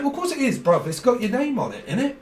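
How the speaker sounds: lightly hammed wide-boy pitch; dodgy watch seller. Seller voiced, defending the name.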